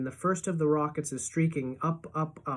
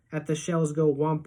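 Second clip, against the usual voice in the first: usual speaking voice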